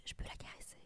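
soft whispered question; whispering. Retold or imitated. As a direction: breathe it without voice. Neighbours asking to pet dog.

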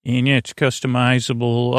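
ordinary talk

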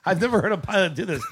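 ordinary delivery

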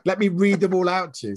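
hammed-up sarcastic willingness; sarcastic mock eagerness. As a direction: pretend you're delighted to oblige, pitched well up. Mocking reply to a joke request.

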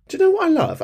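very high, eager question; excited question. Real, not acted. Host about to praise caller, excited and high. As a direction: lift it up high with delight.

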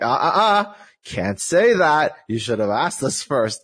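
wag a finger with the voice, drawn out; mock scolding. Developers telling off a player who spoke unconfirmed, mock scolding.

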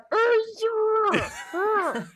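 high, strained squeal of cuteness; squeeze noise. Shown as a demonstration. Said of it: "it's that noise".

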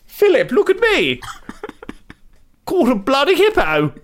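high, slow posh queen voice; hammed-up queen boasting; mock queen. Imitating the queen excitedly.

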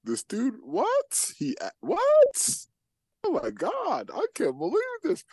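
very high, shocked squeak; high-pitched mock shock; stunned disbelief. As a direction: act out reading it in total disbelief. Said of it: "I'm just like".

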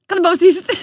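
loud, high irritated snap; snapping shout. Retold or imitated. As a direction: yell at him to shut up. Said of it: "så blir jag så här".

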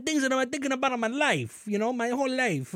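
high-pitched, badly faked Italian accent; mock Italian accent. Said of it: "went a little t-, Italian on the end".

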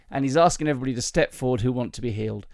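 ordinary delivery

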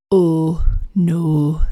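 drawn-out, tentative Welsh lilt; attempted Welsh accent. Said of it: "see if I can do a Welsh accent".

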